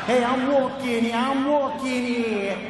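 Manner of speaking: loud, high New York cabbie cat; hammed-up New York street voice; New York accent. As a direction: bark it out like a New Yorker in traffic. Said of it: "If cats could talk".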